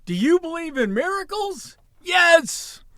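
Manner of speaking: high-pitched sportscaster's yell, stretched and ecstatic; Al Michaels yelling. Retold or imitated. Retelling the 1980 hockey miracle, imitating the yelled call. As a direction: call it like the miracle just happened. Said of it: "Al Michaels yelling".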